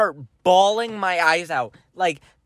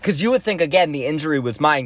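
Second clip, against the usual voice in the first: ordinary talk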